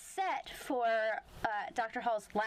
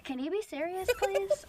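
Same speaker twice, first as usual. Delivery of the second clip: mock exasperated plea, higher; Coulson; 7 semitones higher, 4 dB louder than usual. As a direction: plead for seriousness, louder on the last word. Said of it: "And Coulson's like".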